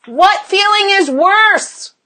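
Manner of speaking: each word drawn out, high, on "What feeling is worse?"; emphatic challenge. Real, not acted. Coach confronting women about their fear, emphatic challenge.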